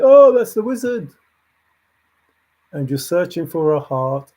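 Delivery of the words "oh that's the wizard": mock awe. Mock awed listener quote.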